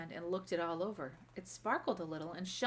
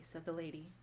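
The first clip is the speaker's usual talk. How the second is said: plain reading voice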